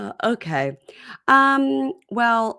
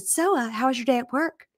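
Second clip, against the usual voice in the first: sweet high voice; 7 semitones higher than usual. Retold or imitated. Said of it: "So she said".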